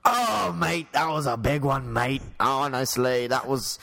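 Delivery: hammed-up Australian mate; Australian accent. Shown as a demonstration. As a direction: launch high into a broad Aussie accent. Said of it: "do an Australian accent".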